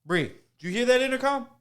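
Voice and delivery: high, loud imitation of urgent questioning; acted agitated shout; calling out. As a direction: call across to a coworker.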